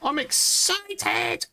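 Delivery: high squealing shriek, slow; overexcited falsetto squeal; excited squeal. Real, not acted.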